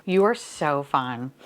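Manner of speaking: ordinary talk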